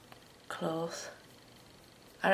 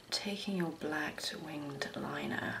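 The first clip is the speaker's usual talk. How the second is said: whispering; 4 semitones lower, 11 dB quieter than usual. Real, not acted. Muttering while doing makeup.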